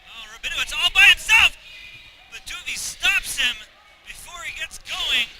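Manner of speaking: loud, high excited play-by-play; excited call. Real, not acted.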